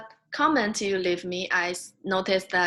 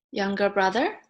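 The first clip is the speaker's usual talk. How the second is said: ordinary talk, nothing marked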